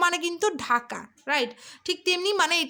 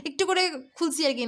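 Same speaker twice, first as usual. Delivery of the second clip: usual speaking voice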